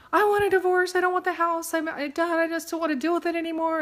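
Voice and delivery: high, loud imitation of a distraught wife; mock crying. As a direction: wail it like an upset client at breaking point. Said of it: "and she's crying".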